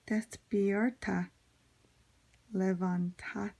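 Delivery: careful, halting Spanish reading by an English speaker; unsure learner's Spanish, long pauses between phrases; halting non-native Spanish. Real, not acted. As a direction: feel your way through the Spanish phrase by phrase. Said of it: "I probably totally slaughtered it".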